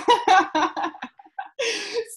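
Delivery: high, loud laugh at her own story; burst of laughter. Real, not acted. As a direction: crack up.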